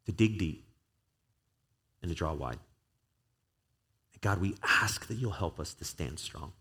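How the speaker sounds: a pastor's prayer that builds, then hushes; building prayer. Acted. Pastor leads closing prayer.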